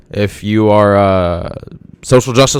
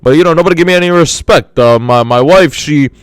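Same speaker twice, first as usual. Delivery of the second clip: a mildly higher, put-upon complaining comic; lightly voiced hard-luck comedian; sad-sack comic voice; 5 semitones higher than usual. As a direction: grumble it like a comic who gets no respect. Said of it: "And then he'll be like".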